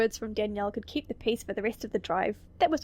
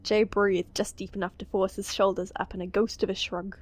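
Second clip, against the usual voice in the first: ordinary talk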